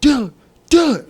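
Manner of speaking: high, loud calls rising to a near scream; retold frantic yelling. Friend yelling to wake a dazed boy, loud high retold calling. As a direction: call the name like a panicked friend. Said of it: "my boy's yelling my name".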